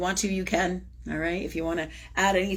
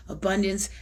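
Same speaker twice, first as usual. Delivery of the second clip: ordinary delivery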